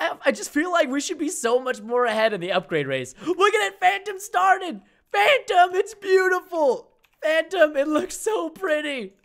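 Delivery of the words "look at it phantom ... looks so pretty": high, slow, swooning gush; gushing, high-pitched. Acted. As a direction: gush over it like a fan. Gushing over Phantom's build.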